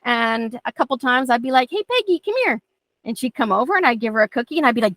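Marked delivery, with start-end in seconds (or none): 1.7-2.6 high, sing-song call to the dog; high sweet dog call. Retold or imitated. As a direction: coax her over in a high bright voice. Calling dog over sweetly.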